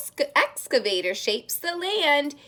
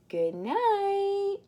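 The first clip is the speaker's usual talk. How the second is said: higher sing-song goodnight; 7 semitones higher, 3 dB quieter than usual. Acted. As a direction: lilt it up. Bedtime goodnight, high.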